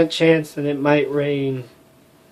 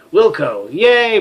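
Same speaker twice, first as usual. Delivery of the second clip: ordinary talk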